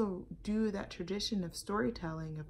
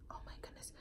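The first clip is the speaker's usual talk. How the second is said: hushed suspense whisper; 17 dB quieter, much faster than usual. Acted. Storyteller whispering to kids as the cat pounces. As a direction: breathe it out in a tense whisper.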